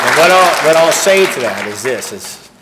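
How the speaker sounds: suddenly loud, starting a big point; loud emphatic launch. Real, not acted. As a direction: burst out with the next thought.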